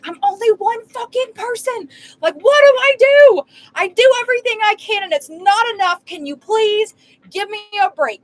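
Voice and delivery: high, loud, breaking voice of despair; desperate crying rant. Retold or imitated. Retelling breakdown in parking lot.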